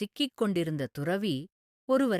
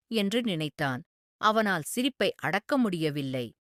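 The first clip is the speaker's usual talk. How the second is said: plain reading voice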